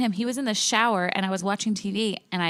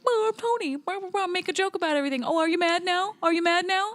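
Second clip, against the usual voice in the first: much higher, taunting imitation; mocking Tony; 9 semitones higher than usual. Imitating her friend Tony's teasing, higher mocking voice. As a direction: parody him as a smug joker. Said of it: "And you were like".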